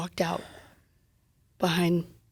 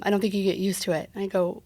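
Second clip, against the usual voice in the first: normal talking voice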